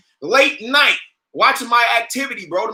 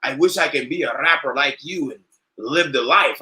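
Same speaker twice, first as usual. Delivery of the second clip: lower, lightly voiced wistful neighbor; mock envious neighbor; 7 semitones lower than usual. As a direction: imitate a dreamy neighbor, voice dropped low.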